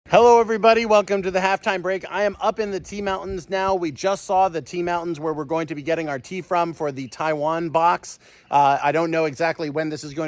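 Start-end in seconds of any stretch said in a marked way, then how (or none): 0.1-8.2 higher, louder upbeat vlog intro; cheerful travel-vlog presenter; vlog host greeting. Acted. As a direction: greet the viewers brightly. Vlog-style halftime greeting.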